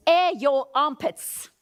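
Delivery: slow, punchy delivery; playful emphasis. Real, not acted. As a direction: relish the phrase. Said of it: "which is glorious".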